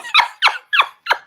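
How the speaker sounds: very high, squealing cackle; gleeful laugh. Acted. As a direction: burst into a shrill cackle. Gleeful reaction to the video.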